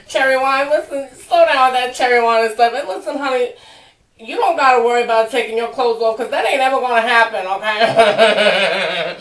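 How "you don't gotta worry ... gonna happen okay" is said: fast teasing broken up by laughter; giggling put-down; laughing teasing. Acted. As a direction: crack up between the jabs. Cracking up while teasing the singer.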